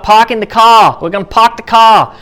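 imitation Boston accent, r's dropped; mock Boston car-commercial accent; strong Boston accent. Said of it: "these very strong accents".

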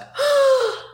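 sharp, high gasp acting out shock; sudden reenacted intake of breath; mock shocked gasp. Reenacts shock before falling apart.

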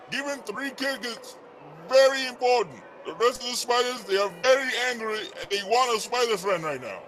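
phone voice. Acted. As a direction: voice coming through a phone. Second caller over the phone.

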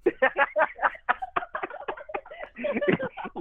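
high cracking laugh; helpless high laugh; real burst of laughter. Real, not acted. Breaks up laughing at insult.

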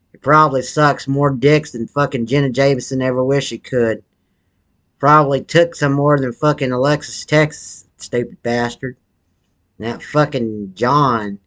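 ordinary talk, nothing marked

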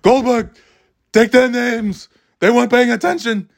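put-on high, barking old New York teacher; Ken Jacobs impression. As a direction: call out orders like a crusty New York old-timer. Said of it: "the very thick New York accent, old guy".

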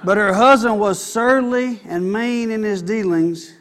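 usual speaking voice

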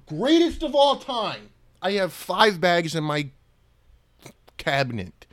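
loud, high hype; excited. Real, not acted. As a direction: shout it out with joy. Raving about favourite chips.

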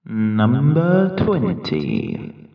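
slow drawn-out caller announcement; bingo caller. Acted. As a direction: call it like a bingo hall caller. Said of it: "I sound like a bingo caller".

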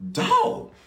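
stunned listener reacting; high astonished yelp; amazed exclamation. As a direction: leap way up in pitch on one word. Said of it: "you would be like".

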